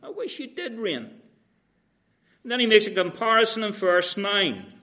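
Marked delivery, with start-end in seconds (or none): 0.0-1.2 higher, softer dry sarcasm; sarcastic. Retold or imitated. Paul repeating the jab to the Corinthians, soft sarcasm. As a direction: say it with a quiet mocking edge. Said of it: "And it's just all sarcasm".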